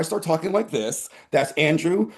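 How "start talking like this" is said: trauma voice. Pretend. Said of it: "He's my trauma voice".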